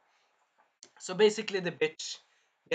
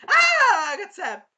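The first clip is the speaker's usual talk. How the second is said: very high, loud shriek tailing into words; hammed-up scream of being shocked; shriek, then squeal the words; zapped scream; 14 dB louder, slower than usual. Acts princess being zapped.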